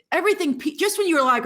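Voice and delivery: normal talking voice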